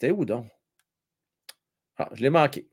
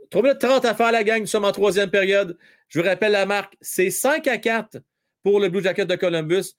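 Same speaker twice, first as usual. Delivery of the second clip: raised, lively game-update voice; play-by-play announcer; 5 semitones higher than usual. Acted. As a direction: call it like a hockey announcer. Live hockey score update.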